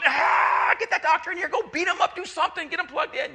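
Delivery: high, loud panicked orders; frantic wife yelling. Retold or imitated. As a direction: yell it like a desperate wife in labor. Wife in labor quoted.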